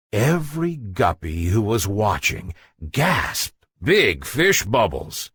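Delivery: plain reading voice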